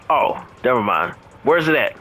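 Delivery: ordinary delivery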